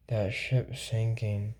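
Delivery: low, slow, soft hammed-up worry; mock concerned. Showing the concerned face to viewers, hammed-up dread. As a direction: drag out "That ship's sinking" like a fake-worried face. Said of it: "my most concerned face".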